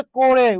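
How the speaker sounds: করে said "ko-re", closed o; o-sound pronunciation. Shown as a demonstration. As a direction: say করে with an o-kar, "ko-re". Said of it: "উচ্চারণটা হচ্ছে করে". (করে-কে ওকার দিয়ে "কোরে" উচ্চারণ)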